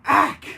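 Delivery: loud, sharp squeal of pain. A narrator's reading. Baroness squeals in pain while transforming. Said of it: "Baroness painfully squealed".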